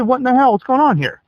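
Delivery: high, exasperated disbelief; reliving the shock of the dead TV; squeaky, exasperated what-the-hell; incredulous outrage. Retold or imitated. Angry retelling of cable outage.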